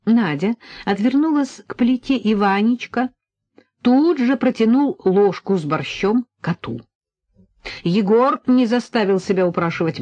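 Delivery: ordinary delivery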